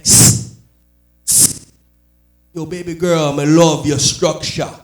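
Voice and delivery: slow, loud Jamaican pick-up line; Jamaican accent. Retold or imitated. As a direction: chat her up in a drawn-out Jamaican accent. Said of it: "just 'cause of his Jamaican accent".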